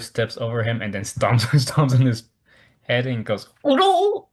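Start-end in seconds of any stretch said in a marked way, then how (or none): 3.6-4.3 high, loud hammed-up yelp; mock alarm. Master stomping on sleeping Kenshin, high mock yelp. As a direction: squeal it like a startled cartoon.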